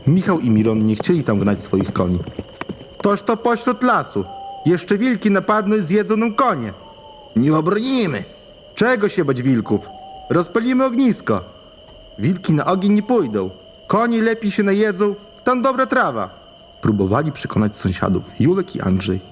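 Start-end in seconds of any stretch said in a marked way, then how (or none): 3.0-6.7 higher, worried peasant voice; worried villager. Acted. Villager fears wolves. 7.4-8.2 a hint of a fearful peasant; worried villager. Villager answers. 8.8-16.3 higher, coaxing peasant voice; persuading villager. Acted. Julek persuades neighbours.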